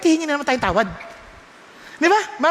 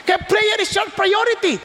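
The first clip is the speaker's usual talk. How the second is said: preaching shout; 7 semitones higher than usual. Real, not acted. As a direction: drive the point home to the congregation. Preacher stressing prayer's priority.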